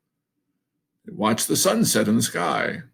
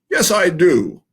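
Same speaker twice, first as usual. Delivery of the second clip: loud, higher defiant cry; indignant storybook outburst, louder; 4 semitones higher, 7 dB louder than usual. A narrator's reading. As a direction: cry it out in protest. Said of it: "Goose cried out".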